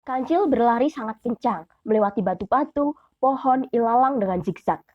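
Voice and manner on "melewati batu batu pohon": ordinary delivery, nothing marked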